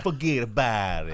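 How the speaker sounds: loud mock Italian accent; Robert De Niro. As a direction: toss it out as a cartoon Italian. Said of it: "Robert De Niro's running around going".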